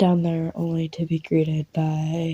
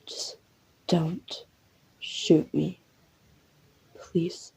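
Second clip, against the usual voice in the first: hushed plea, word by word with pauses; careful pleading; 6 dB quieter, much slower than usual. Acted. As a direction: beg softly, one careful word at a time. Pleads not to be shot.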